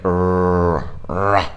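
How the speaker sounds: "Rrr" growled like a dog, curled and untrilled; exaggerated dog r. Shown as a demonstration. Teacher exaggerating the r like a roaring dog. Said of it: "Try to exaggerate that sound".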